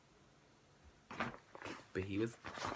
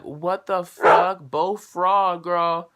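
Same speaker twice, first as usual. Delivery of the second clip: higher, louder, slow disbelief; outraged; 6 semitones higher, 7 dB louder, much slower than usual. Retold or imitated. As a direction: spit it out in shock. Retold outraged reply to clerk.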